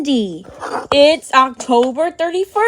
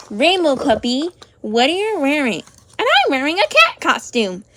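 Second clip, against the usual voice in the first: ordinary talk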